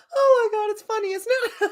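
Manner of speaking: high, laughing through the words; real giggling. Real, not acted. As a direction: speak while cracking up. Laughing through the peek exercise.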